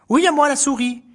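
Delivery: high little mouse answer. Acted. Mouse answering the hare, high. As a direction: chirp it back.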